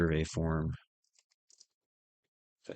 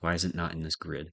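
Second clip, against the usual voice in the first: usual speaking voice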